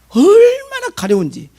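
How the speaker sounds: 얼마나 drawn out high and loud; emphatic exclamation. Real, not acted. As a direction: stretch 얼마나 way up, then drop. Emphasizing how itchy it was. (얼마나를 높고 길게 강조)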